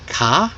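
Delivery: "ka" with no R at the end. Retold or imitated. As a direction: drop the R and leave an open vowel on "ka". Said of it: "in a British style".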